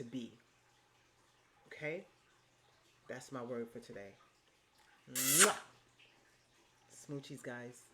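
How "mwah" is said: loud smacking air kiss to viewers; playful loud air kiss to sign off; kiss sound. Real, not acted. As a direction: blow the audience a big kiss. Blowing a kiss goodbye.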